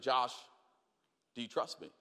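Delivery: a father gently asking trust; dad's quiet question. Retold or imitated.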